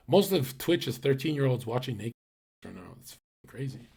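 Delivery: ordinary talk, nothing marked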